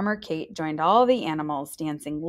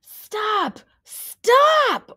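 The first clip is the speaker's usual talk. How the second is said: high loud shouted stop, rising louder; yelled stop; 7 dB louder than usual. A narrator's reading. Ollie yelling at the dancing animals to stop. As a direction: shout over the music to stop them. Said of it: "Ollie yelled".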